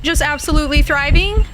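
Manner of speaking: loud mock greeting. Greeting the ghost loudly.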